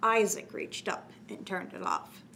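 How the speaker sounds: usual speaking voice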